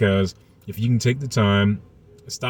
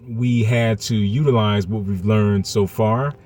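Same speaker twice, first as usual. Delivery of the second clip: ordinary delivery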